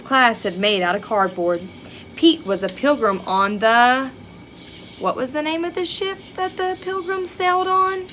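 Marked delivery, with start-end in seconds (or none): 5.0-8.0 quizzing question. Acted. Teacher quizzing children.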